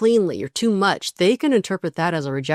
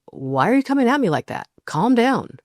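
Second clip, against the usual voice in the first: higher, quick, mock-defensive pushback; a dismissive partner deflecting the complaint; defensive deflection; 6 semitones higher than usual. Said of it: "A deactivating response from your partner".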